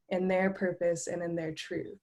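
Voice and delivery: ordinary talk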